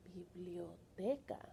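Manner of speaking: slow hushed biblioteca; whisper. Real, not acted. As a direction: whisper the word slowly. Whispered Spanish word.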